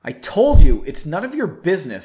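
firm, with a loud punch at the start; stern. A narrator's reading. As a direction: put your foot down sternly. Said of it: "she said sternly".

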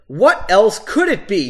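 fed up, demand the obvious; exasperated. A narrator's reading. Woman shaking her head at him, exasperated. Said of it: "clearly exasperated".

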